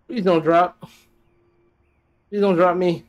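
loud desperate begging; pleading. Real, not acted. Gamer begging the game mid-jump, loud pleading. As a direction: beg the game not to drop you.

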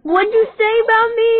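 high, loud, offended cry; indignant outburst. Real, not acted. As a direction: demand an answer, offended. Thinks she was called ugly.

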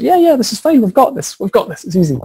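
lightly voiced carefree pupils; confident students. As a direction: imitate cocky students brushing it off. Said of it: "everyone was like".

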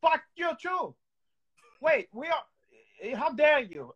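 ordinary talk, nothing marked

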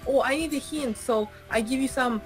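usual speaking voice

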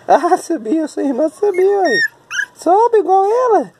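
very high, encouraging squeal of words. Real, not acted. Parent urging baby to climb like the sister, high cheering.

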